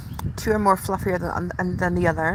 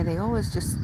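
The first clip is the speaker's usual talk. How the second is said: ordinary talk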